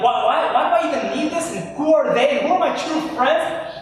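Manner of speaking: high, loud, resentful questioning; mock-bitter ranting at friends. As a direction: spit out the grievance with rising heat. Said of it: "and you're like".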